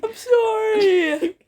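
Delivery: slow, high sorry through laughter; laughing apology. Real, not acted. Apologising to co-reader, laughing sorry. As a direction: giggle out the apology.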